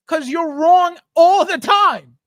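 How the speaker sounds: high, loud, drawn-out scolding; indignant shout. Real, not acted. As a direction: hammer it home slowly at full volume. Scolding attackers in his chat.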